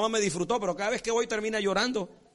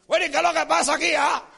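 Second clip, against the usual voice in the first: loud, fast, higher-pitched angry demand; gruff demanding husband; 7 semitones higher, 7 dB louder than usual. Acted. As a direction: bark it like a husband storming in. Husband coming home barking at family. (marido que llega a casa regañando a gritos)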